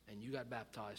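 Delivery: usual speaking voice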